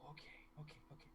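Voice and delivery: very soft, breath-only agreeing; hushed little girl. Acted. Little girl agreeing to keep the secret, hushed. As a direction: whisper it quickly as an awed child. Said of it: "she goes".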